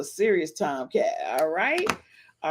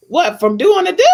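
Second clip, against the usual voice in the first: surprised; 8 semitones higher, 8 dB louder than usual. Acted. Shocked comic question.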